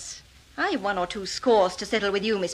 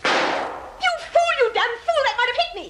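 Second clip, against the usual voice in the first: high, shrill furious cry; furious outburst; 12 semitones higher than usual. Acted. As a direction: scream at the fool who fired. Shot just missed her.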